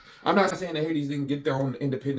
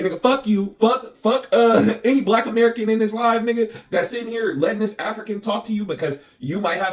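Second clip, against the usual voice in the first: loud, high-pitched cussing out; heated retold tirade, punching the first curses; re-enacted rant; 7 semitones higher, 5 dB louder than usual. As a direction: replay your angry outburst, pitched up and loud.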